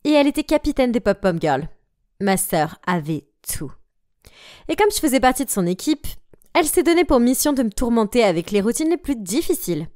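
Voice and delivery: ordinary delivery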